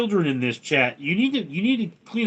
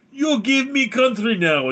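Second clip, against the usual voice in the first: high, loud mock-Russian demand; Russian accent; 9 semitones higher, 5 dB louder than usual. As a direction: order it in a hammed-up Russian accent. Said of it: "Russians say to Ukraine".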